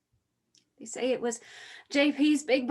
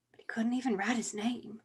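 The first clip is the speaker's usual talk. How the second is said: whispered, sorrowful aside; whispered regret; 5 dB quieter than usual. Acted. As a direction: drop to a whisper of grief. Bitter sorrow about father.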